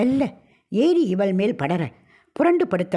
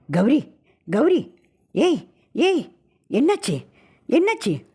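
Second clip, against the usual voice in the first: high, urgent calls to wake her; husband calls his terrified wife, high and frantic; frantic calling; 8 semitones higher, slower than usual. Acted. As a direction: shake her awake with your voice.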